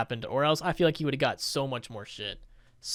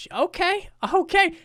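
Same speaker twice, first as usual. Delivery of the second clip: high-pitched, delighted disbelief; impressed surprise; 12 semitones higher, 3 dB louder than usual. Real, not acted. YouTuber seeing a small channel's huge views, in high-pitched surprise. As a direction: voice leaps up, hugely impressed.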